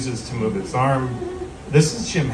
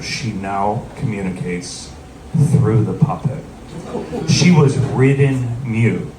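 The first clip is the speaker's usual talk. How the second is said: very serious; 3 semitones lower than usual. Retold or imitated. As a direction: weigh every phrase solemnly, with heavy pauses. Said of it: "she gets very serious".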